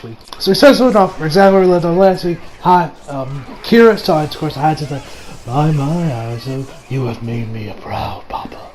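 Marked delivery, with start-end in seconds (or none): 5.5-8.7 slow, whispered villain praise; imitating proud father. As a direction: purr it out like a creepy proud dad. Father figure praising son.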